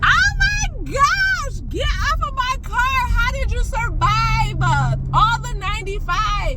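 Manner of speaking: real high-pitched alarm; freaking out. Real, not acted. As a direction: wail at the intruder to get away. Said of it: "I'm freaking out".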